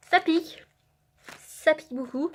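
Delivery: strained from the spice; mouth burning from spicy food. Real, not acted. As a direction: still feeling the burn.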